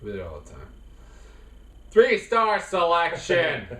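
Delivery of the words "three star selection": slow, drawn-out segment title; announcer voice. Acted. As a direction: announce it like a show host.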